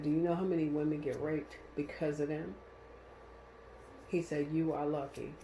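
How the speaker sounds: soft, sober retelling; subdued, serious quote; grave warning, retold. Tow driver gravely warning her.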